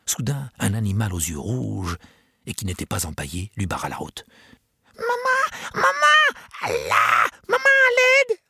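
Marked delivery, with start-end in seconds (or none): 5.0-8.4 high, loud panicked cry for mum; desperate cry. A narrator's reading. Chick calling mother for help, shrill panicked shout. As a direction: chick screaming for its mother in terror. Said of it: "cria-t-il désespéré".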